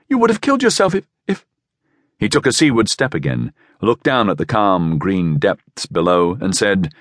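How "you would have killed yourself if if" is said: high, rushed, breaking off; shocked, faltering. A narrator's reading. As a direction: rush it high, then falter. Shocked woman falters over his jump.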